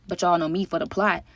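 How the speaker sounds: usual speaking voice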